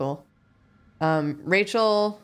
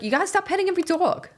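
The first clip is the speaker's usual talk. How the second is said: put-on Long Island accent; 13 semitones higher, faster than usual. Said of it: "She was like".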